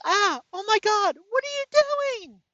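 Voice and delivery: a staged scream for the neighbours; fake scream. Wife fakes a scream at the open window, hammed-up high shriek. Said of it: "And she screams".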